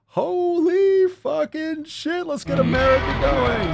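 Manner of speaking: high, slow stretched exclamation; amazed hype. Real, not acted. Host amazed by a fan's weight loss, high and stretched. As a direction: marvel out loud.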